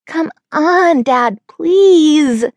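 high, loud whiny plea; begging. A narrator's reading. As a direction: beg like a whiny toddler. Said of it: "I begged".

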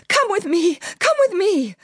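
high, urgent frightened plea; panicked cry. A narrator's reading. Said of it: "the Memsahib cried".

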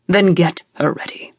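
low, curt father voice; a hint of a stern father; cold father. Father answers coldly.

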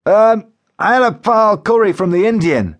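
high-pitched, strained admission; Joe Windass, suffering caller. A narrator's reading. Joe answers what he has eaten, high and distressed. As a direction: own up to last night's meal in distress.

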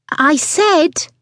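high, slow, insistent customer voice; impatient repeat. Acted. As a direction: spell it out for someone not listening. Ignored customer repeats her request.

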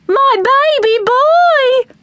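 high, loud, long-drawn shriek. A narrator's reading. Mum sees son in gorilla pen, shrieking. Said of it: "Mum shrieked".

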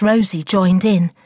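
ordinary delivery, nothing marked

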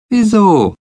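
put-on very high, loud girlish why; childlike high question; puzzled question. A narrator's reading. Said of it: "fragte Betsy".

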